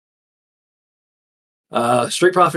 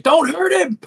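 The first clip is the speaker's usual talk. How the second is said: high-pitched mock scream; imitated crowd scream; 3 dB louder, slower than usual. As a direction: scream it like a panicked fan. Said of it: "people to our right that were screaming it".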